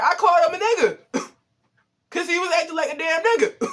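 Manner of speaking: laughing confession to mom; laughing admission. Real, not acted. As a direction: own it while cracking up. Admitting it while laughing.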